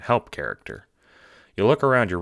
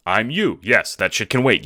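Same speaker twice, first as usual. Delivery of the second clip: urgent; 6 semitones higher, 7 dB louder than usual. Acted. Clone urgently grabs your shoulders.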